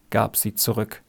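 ordinary delivery

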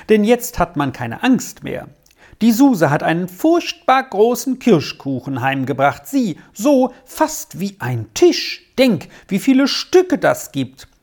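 put-on high-pitched chattering child character; eager, delighted Squirrel. Squirrel tempts Arthur with cherry cake, eager and delighted. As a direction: gush about a treat in a high, lively voice.

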